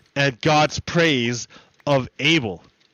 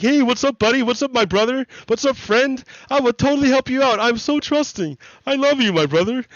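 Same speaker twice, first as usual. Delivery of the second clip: much higher, fast, gushing sweet voice; naive trusting Abel; 10 semitones higher, faster than usual. Retold or imitated. Abel greeting his brother Cain, high and gushing. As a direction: play the dopey little brother who trusts everyone. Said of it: "Abel walks over like".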